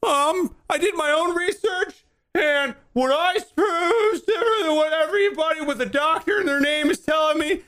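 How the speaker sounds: very high, whiny mock voice. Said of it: "put on their fucking tinfoil hat".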